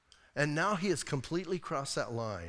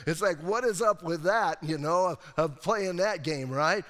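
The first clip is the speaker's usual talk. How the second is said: normal talking voice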